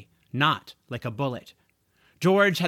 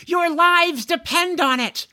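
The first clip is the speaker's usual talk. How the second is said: loud, high desperate yell; yelling warning; 6 dB louder than usual. Acted. George yelling at the smokers in warning. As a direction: shout like lives depend on it. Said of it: "he yelled".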